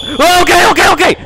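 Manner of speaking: very high, loud frightened squeal; panicked yelp. Real, not acted. Player startled by the little ghost girl, panicked squeal. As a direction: give in at once, shrieking in panic.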